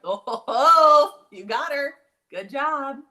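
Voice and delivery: loud, high cheering; excited praise. Real, not acted. Mom praising her son for catching the dog, loud and high. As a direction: cheer him on loudly.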